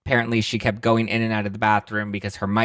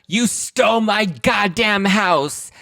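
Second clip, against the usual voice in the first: slow, much higher, slurred yell; drunken accusation; 12 semitones higher, 4 dB louder, much slower than usual. Retold or imitated. Kim accuses Kyle in the limo, slurred high yell.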